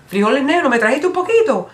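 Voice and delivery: high, eager questioning; acted eager delight. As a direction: ask with greedy excitement. Acts delighted reply to mami with beans.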